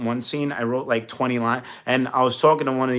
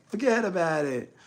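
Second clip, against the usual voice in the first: put-on higher teasing New York accent; mocking New York accent; 8 semitones higher than usual. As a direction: imitate the ex imitating him. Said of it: "She, like".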